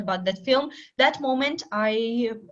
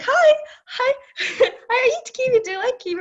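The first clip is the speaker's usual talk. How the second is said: mock greeting; 12 semitones higher, 4 dB louder, much faster than usual. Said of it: "and you say".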